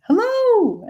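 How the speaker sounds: very high, falling sing-song hello. Retold or imitated. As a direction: chirp it up high like a cheery old lady. Retold sing-song greeting from woman.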